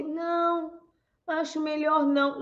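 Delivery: high, soft, drawn-out no; hammed-up wishy-washy refusal; mock hesitant refusal. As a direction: play a feeble, reluctant no. Mock timid refusal, contrasted with firm one.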